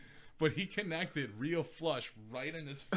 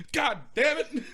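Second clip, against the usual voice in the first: mock curse; 8 dB louder than usual. Retold old man cursing after hit.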